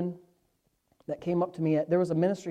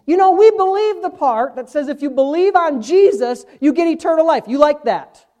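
higher, louder, slowing to the punchline; preaching at full force; 11 semitones higher, 13 dB louder than usual. Acted. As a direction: drive the point home from the pulpit.

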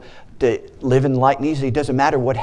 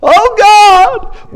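much higher and louder cry; preacher mimicking panicked believers; mock panic; 10 dB louder than usual. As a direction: cry out like a scared disciple. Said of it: "we've been like the disciples".

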